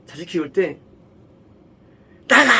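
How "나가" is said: furious parent yelling out; angry shout. Retold or imitated. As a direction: bark get out at the child. Angry parent throwing child out. (화난 아버지의 고함)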